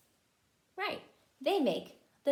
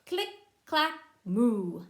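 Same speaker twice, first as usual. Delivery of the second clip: typewriter and moo sounds; 3 dB louder than usual. Acted. Cows typing sound effect.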